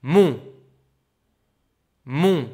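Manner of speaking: mon said loud with the nasal on; teacher reading a sound to identify. Shown as a demonstration. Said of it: "si je dis".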